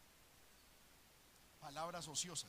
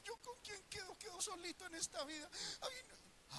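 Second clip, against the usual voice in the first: soft, much higher, crying worried whimper; fearful whimper; 8 semitones higher, 4 dB quieter than usual. Retold or imitated. Grown man fearing losing everything, mock crying whimper. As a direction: whine it like a frightened orphan. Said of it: "Si yo perdiera todo".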